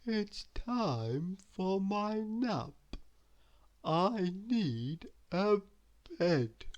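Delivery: very slow, soft drowsy voice; sleepy Mister Lazy. A narrator's reading. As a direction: drift off mid-sentence. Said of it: "he yawned".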